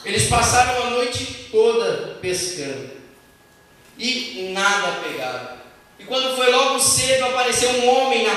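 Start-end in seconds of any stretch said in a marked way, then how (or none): none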